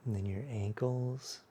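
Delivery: unhurried, hushed yoga-class body scan; guided meditation. Acted. Leads a guided relaxation.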